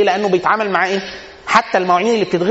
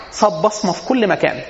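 normal talking voice

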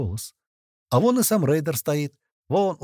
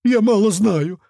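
much higher, loud scared cry; frightened confession; 10 semitones higher, 7 dB louder, slower than usual. A narrator's reading. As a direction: blurt it out in fear. Испуганный пленник сдаётся. (испуганно выкрикивает, высоко)

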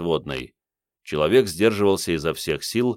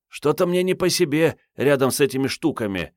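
put-on higher, uneasy girl's voice; uneasy young woman; 9 semitones higher than usual. A narrator's reading. Marietta uneasy.

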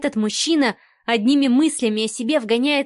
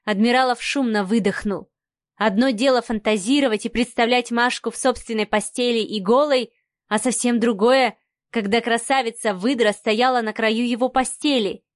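usual speaking voice

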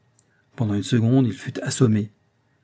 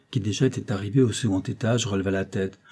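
ordinary delivery, nothing marked

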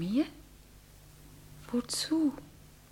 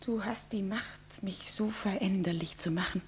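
much softer, hushed confession; soft whisper; 3 semitones lower, 9 dB quieter than usual. Acted. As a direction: let it fall to a whisper. Intimate confession to lover. (leise, hingehauchtes Geständnis)

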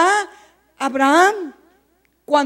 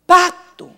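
"Pacto" said alone, punched louder; stress on pacto; 4 dB louder than usual. Shown as a demonstration. Preacher stressing the word to the congregation, punched. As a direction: land hard on "Pacto" as its own word. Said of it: "Quiero remarcar la palabra pacto".

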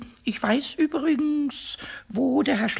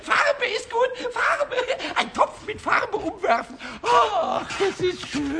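much higher, laughing and half-shouting; gleeful excitement; 8 semitones higher, slower than usual. Acted. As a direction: squeal with mischievous joy at the thought. Pumuckl delighting in the mischief.

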